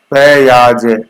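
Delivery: "प्याज" broken loudly into "पे-याज"; split for memorizing. Shown as a demonstration. Teacher giving students a memory trick, split up and emphatic. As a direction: stretch "प्याज" out as pe-yaaj, punched. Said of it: "प्याज से याद रख लो इसको".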